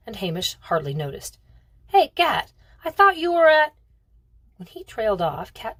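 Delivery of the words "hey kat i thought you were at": put-on high, loud boyish yell of greeting; thrilled shouted greeting, cut off short; excited shout. A narrator's reading. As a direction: holler hello across the kitchen. Brother shouts greeting to Kat.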